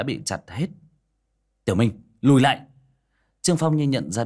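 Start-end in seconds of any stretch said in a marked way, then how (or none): 1.6-2.6 urgent warning. A narrator's reading. Trương Phong warns Tiểu Minh to step back, urgent call. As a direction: call out sharply, louder.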